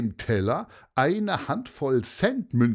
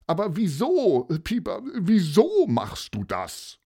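high, louder insisting why; exasperated plea; 7 semitones higher, 4 dB louder than usual. Acted. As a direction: Paulus pressing Pieper for a reason. Paulus exasperated, demanding answer.

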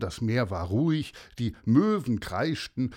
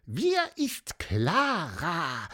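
high, squeaky official demand; piping little bird; 11 semitones higher than usual. A narrator's reading. Pieper demands information about Clara, high insistent chirp. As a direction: demand the facts in a tiny bird voice. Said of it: "piepste Pieper lauthals".

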